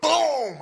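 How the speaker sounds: loud high imitated bang; gunshot boom. Imitating the gun going off, loud bang. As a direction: burst out with a gun blast noise.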